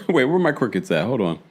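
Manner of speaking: ordinary delivery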